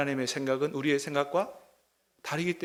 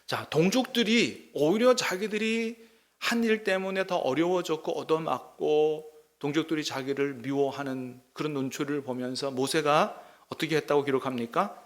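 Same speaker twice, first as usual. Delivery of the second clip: ordinary talk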